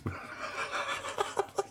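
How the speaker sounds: high breathy giggle rising; helpless laugh at the absurdity; high gasping laugh. Real, not acted. Bursts out laughing.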